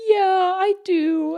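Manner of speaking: put-on slow, high sweet old man; John Miller. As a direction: draw it out like a pleased elderly regular. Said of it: "a really sweet old man".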